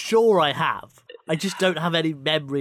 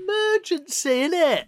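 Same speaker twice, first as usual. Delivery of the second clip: high squeaky voice; high-pitched; 11 semitones higher than usual. Pretend. Mock high emergency voice.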